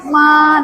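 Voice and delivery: "ma" held high and level; first tone. Shown as a demonstration. Teacher modelling the first syllable of mama, first-tone demo. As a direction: sustain "ma" on one flat high note. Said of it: "nada satu".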